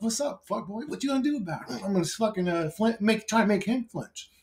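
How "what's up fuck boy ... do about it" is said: high, quieter tough-guy dare; cocky taunt. Pretend. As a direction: dare him to swing first.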